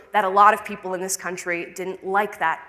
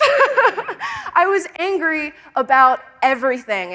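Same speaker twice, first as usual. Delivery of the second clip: laughing; 6 semitones higher, 4 dB louder, slower than usual. Real, not acted. Laughs at past self.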